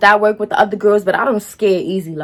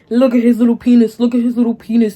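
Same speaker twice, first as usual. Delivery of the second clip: taunting put-on voice, a bit louder; mock the girl as she jeers at him; sneering imitation of the caller; mocking girl's voice; 3 dB louder than usual. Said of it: "for YouTube's sake".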